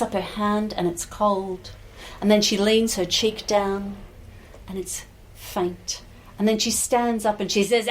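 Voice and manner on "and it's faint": plain reading voice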